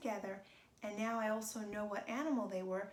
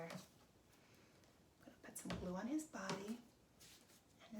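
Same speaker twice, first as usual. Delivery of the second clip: slow, breathy hush; slow whisper; 9 dB quieter, slower than usual. Acted. Gluing the alligator for kids, slow whisper. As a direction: ease it out in a whisper. Slow hushed crafting narration.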